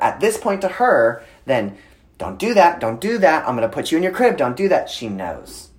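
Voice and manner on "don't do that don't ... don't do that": higher, louder run of mock scolding; retold parental nagging. Dad warning toddler about crib, mock scolding.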